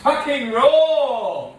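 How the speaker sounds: slow, high, loud chef yell; Gordon Ramsay. Retold or imitated. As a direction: bellow it like an angry TV chef. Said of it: "a little Gordon Ramsay moment".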